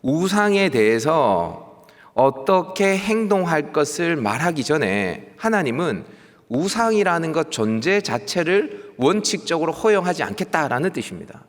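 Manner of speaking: ordinary delivery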